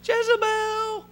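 high, slow, drawn-out whiny call; Ahab's high-pitched voice. Retold or imitated. Ahab runs home calling for Jezebel, high whiny voice. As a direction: call her name like a weak king who needs his wife. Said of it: "I can just hear this high-pitched voice".

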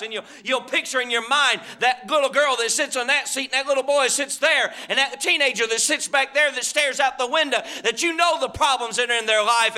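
loud, ringing preacher's cadence; fervent revival preaching; preaching at full force. Acted. Preacher's fervent appeal on prayer.

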